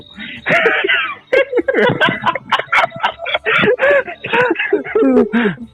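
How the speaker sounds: high, shrieking laughter; long laugh. Real, not acted. Cracking up while telling a friend how he won his sister over.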